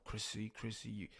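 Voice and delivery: a hushed hint of a creepy monster; creepy Vecna voice. Retelling the villain calling his victim on the stairs, lightly creepy. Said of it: "in this creepy voice".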